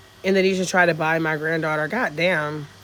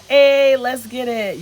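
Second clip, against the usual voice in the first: loud, higher, slow hype cheer; 7 semitones higher, 9 dB louder, much slower than usual. Real, not acted. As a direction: cheer them on, big and drawn out. Cheering a viewer's purchase.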